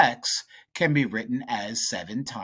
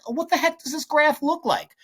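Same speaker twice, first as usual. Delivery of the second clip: high-pitched, louder mock student voice; confused student; 4 dB louder than usual. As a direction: voice a baffled student's question way up high. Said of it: "if you're like".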